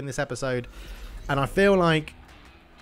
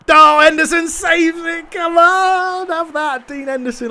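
much higher, much louder jubilant yell; ecstatic shout; 11 dB louder, slower than usual. Real, not acted. Player celebrating his keeper's penalty save, ecstatic shout. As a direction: scream with joy at the save.

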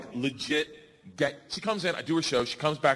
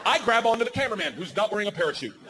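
ordinary talk

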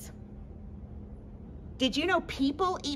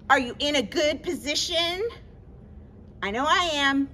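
ordinary delivery

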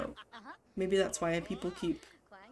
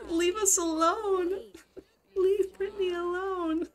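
mock pleading; 9 semitones higher, 4 dB louder than usual. Player begging game characters to leave them alone, mock pleading. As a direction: plead at the top of your voice, hammed up.

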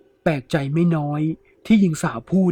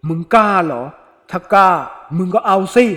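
louder, higher, daring him to try; loud taunting dare; 3 semitones higher, 7 dB louder than usual. Acted. Ghost woman dares the thief, loud taunting. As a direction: throw the dare right in his face.

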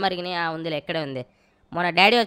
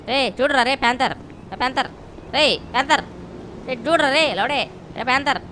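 calling the dog Panther by name; 5 semitones higher than usual. Real, not acted. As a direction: call out for the dog.

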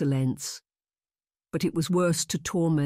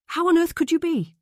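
high, loud protest; indignant cry; 7 dB louder than usual. Acted. Miss Lily protests her friend's self-doubt, high and loud. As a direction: cry it out in disbelief. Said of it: "Miss Lily cried".

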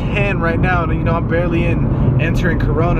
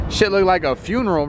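ordinary talk, nothing marked

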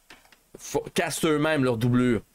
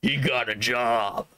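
slow, lower Austrian-action-star voice; Schwarzenegger; 4 semitones lower, slower than usual. Acted. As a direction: imitate a movie tough guy, low and drawn out. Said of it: "Il a eu la voix de Schwarzenegger". (imitation lente et grave de Schwarzenegger)

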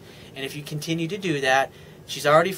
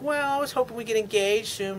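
lightly voiced hopeful girlfriend, higher; a hint of the girlfriend, pitched up; girlfriend's voice; 7 semitones higher than usual. Coach imitates the girlfriend hinting at engagement, lightly higher. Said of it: "She's like".

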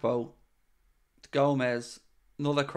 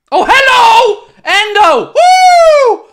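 much higher, loud joyful yell; ecstatic shout; 12 dB louder, much slower than usual. Real, not acted. Fan screams with joy as Endo equalises. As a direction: explode with joy at the goal.

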